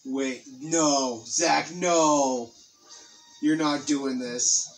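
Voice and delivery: ordinary talk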